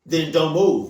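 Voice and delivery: firm emphatic command. Real, not acted. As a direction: put your foot down on it. Same advice said louder.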